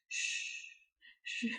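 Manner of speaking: drawn-out imitated "shh"; hushing shh. Husband hushing his labouring wife, soothing shush. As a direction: hush someone, all breath, no voice.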